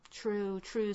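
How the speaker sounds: ordinary delivery, nothing marked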